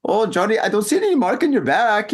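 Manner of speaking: high-pitched, badly faked German accent; terrible German accent. Friend inspecting the back, bad German accent. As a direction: do a drunk attempt at a German accent. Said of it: "that's my German accent. It's terrible".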